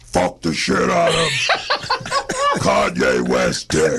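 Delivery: possessed voice; demon voice. Pretend. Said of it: "Do the demon voice".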